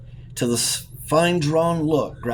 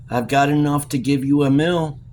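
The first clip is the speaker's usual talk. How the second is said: a louder, savage retort; savagely; 4 semitones higher, 5 dB louder than usual. A narrator's reading. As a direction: snap it back, fierce and loud. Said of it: "said Jelks savagely".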